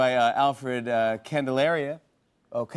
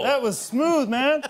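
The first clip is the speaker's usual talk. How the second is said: excited; 11 semitones higher, 4 dB louder, slower than usual. Real, not acted. Hyping up partner excitedly.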